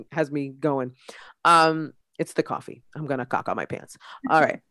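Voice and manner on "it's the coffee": ordinary delivery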